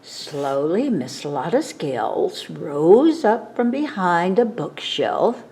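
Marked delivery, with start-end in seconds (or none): none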